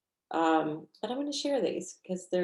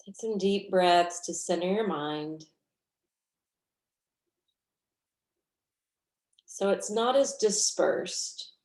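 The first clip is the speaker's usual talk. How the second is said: guided meditation. Real, not acted. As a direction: guide them gently through the breath, unhurried.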